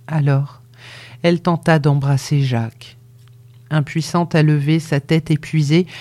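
ordinary delivery

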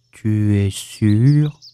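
low, very slow question; gloomy donkey. A narrator's reading. Donkey doubting the bear about his tail, gloomy. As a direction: ask it ponderously in a deep voice.

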